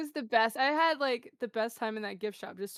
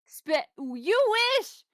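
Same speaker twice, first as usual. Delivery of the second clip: "you wish" drawn out slowly, much higher and louder; scoffing you wish; 10 semitones higher, 6 dB louder, much slower than usual. Real, not acted. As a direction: scoff at the idea, loud and slow. Mock scoff at Spanish idea.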